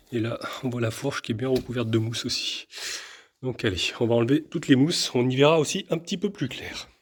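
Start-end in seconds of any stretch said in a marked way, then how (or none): none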